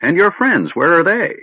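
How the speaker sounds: a suddenly sharp mage pressing for answers; stern old wizard. Acted.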